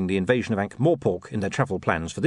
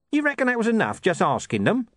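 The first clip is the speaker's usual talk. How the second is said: lightly voiced sceptical Nobby; Nobby's doubt; 12 semitones higher than usual.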